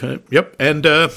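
usual speaking voice

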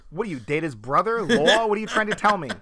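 loud, high mock Boston indignation; Boston accent. Bostonian baffled by the word law, mock Boston accent. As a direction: protest loudly in broad Boston. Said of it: "exactly opposite of Boston".

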